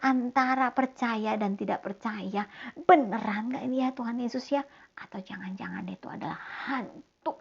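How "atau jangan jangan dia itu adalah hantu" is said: fearful whisper. Acted. Peter doubts, whispered aside.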